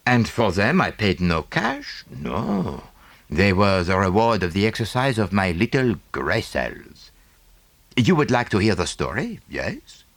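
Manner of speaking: a lightly voiced fussy Belgian detective; Poirot, French accent. Poirot offers story.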